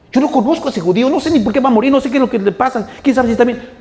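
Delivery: loud, high rant of denial; cursing denial. Retold or imitated. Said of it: "Empezó a maldecir".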